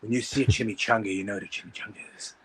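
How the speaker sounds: low, murmured Baldwin voice drifting into a whisper; Alec Baldwin impression. Comic pal doing Alec Baldwin over chimichangas, low murmur. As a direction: sink low and breathy like a smoky Baldwin. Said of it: "Alec Baldwin".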